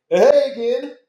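high, loud mock fan hello; hammed-up pally greeting, way up high; over-eager greeting. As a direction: call out like a pest who won't leave. Imagined awkward over-eager greeting.

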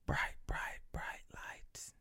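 eerie whispered bit; creepy whisper about the devil. Acted. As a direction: whisper it like a spooky secret. Spooky whispered joke.

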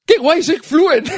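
high, drawn-out gleeful voice, laughing; thrilled self-quote. Acted. Bilingual man's inner thought on his English, mock thrilled. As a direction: marvel at yourself in a high, amused voice. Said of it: "te quedas por un segundo pensando".

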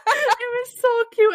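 high, slow, gushing through laughter; laughing. Real, not acted. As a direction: melt over how cute it was. Gushing over a radio clip.

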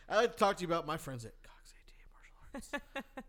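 hushed teasing whisper; whispered ad read. Pretend. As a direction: whisper the sponsor line.

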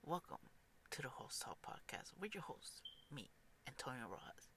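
announcer intro. Acted. Podcast host intro.

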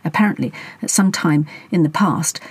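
usual speaking voice

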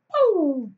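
high, loud falling whoop; imitated siren burst. Three patrol cars arrive in a rush, imitated loud siren.

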